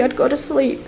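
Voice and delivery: ordinary delivery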